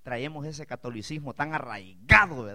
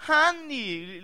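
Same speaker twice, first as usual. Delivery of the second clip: imitating the cashier; 11 semitones higher, 7 dB louder than usual. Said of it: "esta morenita".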